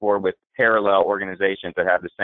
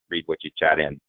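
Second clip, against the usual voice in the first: ordinary talk, nothing marked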